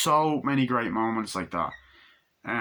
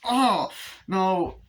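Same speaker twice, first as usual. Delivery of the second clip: put-on high girly oh no; 8 semitones higher than usual. Voicing Annie spilling.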